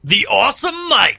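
high, loud proclamation; mock awestruck shout. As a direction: shout it as an overawed onlooker. Acts out an awestruck onlooker.

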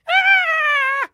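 high, loud held shriek; frenzied screech. Acted. Acting out the story's screech, frenzied. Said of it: "by a frenzied screech".

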